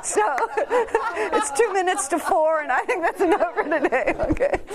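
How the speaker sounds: high, loud, breaking up with laughter; real laughter lifting the voice; laughing. Real, not acted. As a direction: try to wrap up while cracking up. Closing talk amid audience laughter.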